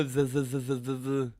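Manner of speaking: lisped z sounds from the tongue tip; slushy lisp. Shown as a demonstration. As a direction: buzz "z-" with the tongue between the teeth. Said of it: "quelqu'un qui parle du bout de la langue".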